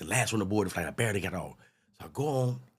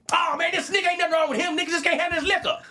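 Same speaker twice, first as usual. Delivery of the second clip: much higher scornful passenger voice; high mocking voice; 4 dB louder than usual. Passenger scoffing that he just can't hold liquor, high voice. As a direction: pitch it way up and sneer.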